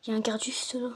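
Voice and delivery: hushed whisper near a guard. Real, not acted. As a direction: whisper so the guard won't hear. Warning of a nearby guard.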